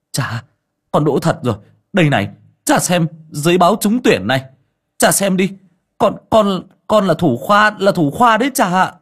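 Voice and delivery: high and loud, near tears with joy; tearful excitement. A narrator's reading. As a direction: cry out the news to father, overjoyed. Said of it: "rơm rớm nước mắt". (con trai rưng rưng reo lên với cha)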